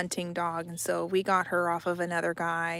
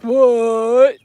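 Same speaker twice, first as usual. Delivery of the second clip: dog's voice, loud; 3 semitones higher, 14 dB louder than usual. Retold or imitated. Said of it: "she'd be looking at me like".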